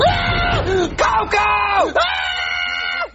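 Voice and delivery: high shrieking scream; terrified scream. Acted. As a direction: scream in horror. Screaming at Coco.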